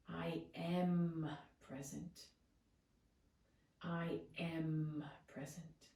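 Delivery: slow affirmation with "am" held long; calm, weighted "am" before "present"; stress on AM. Shown as a demonstration. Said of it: "And then the next word".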